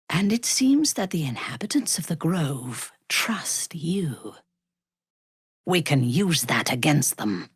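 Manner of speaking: hushed conspiratorial whisper; scheming whisper. Acted. A schemer whispering to the player about the Grove. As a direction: whisper it as a plot.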